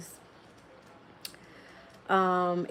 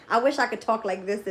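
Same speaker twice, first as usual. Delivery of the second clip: usual speaking voice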